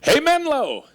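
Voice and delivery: a big high call to the whole room; a booming hall-wide shout of greeting; loud call. Shown as a demonstration. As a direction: call out to get everyone's attention. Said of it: "here's the way it works".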